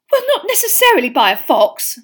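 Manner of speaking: high, loud, distressed protest; tearful. A narrator's reading. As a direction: wail it through tears. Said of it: "with streaming eyes".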